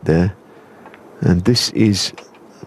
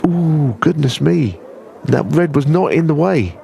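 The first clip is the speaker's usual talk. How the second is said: surprised; 7 semitones higher, slower than usual. Real, not acted. Commentator reacts to a shocking miss.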